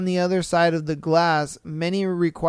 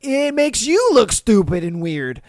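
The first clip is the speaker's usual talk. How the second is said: usual speaking voice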